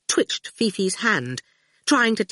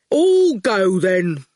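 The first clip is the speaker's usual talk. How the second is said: slow, heavy-witted thug, words dropped one by one; menacing henchman taking his time; slow lumbering thug; slow goon voice; 2 semitones higher, 4 dB louder, much slower than usual. Acted.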